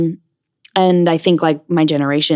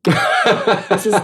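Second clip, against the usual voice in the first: laughing; 5 semitones higher than usual. Real, not acted. Laughing about being late.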